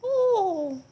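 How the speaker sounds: high, drawn-out hammed-up British accent; fake British accent. Auditioning as fairy godmother, crazy fake British accent. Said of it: "this crazy fake British accent".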